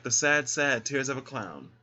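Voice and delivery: lightly imitating [name]'s sung line; a light hint of a drag-queen [name] sing-song; Ginger Minge as [name]. Quoting a drag queen's [name] impression, lightly sung. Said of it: "Ginger Minge as [name]".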